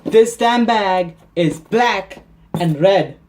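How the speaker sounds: slow, loud, high-pitched outburst; hammed-up fed-up shout; exasperated. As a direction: hammer out each word in exasperation. Exasperated answer to repeated questions.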